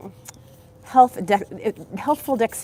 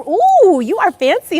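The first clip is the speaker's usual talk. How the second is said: impressed ooh; 9 semitones higher, 10 dB louder, slower than usual. Real, not acted. Teases viewer's fancy guess.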